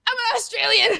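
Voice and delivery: high-pitched incredulous cry; mock shock. Drunk friend told she sounds Australian, mock shock. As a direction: squeal it in drunk astonishment.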